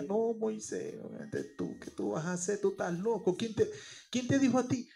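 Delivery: scoffing, incredulous people, pitch swinging wide and dropping soft; mocking doubt. Israelites scoffing at Moses for his plan, mocking disbelief. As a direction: sneer at Moses like he's lost his mind.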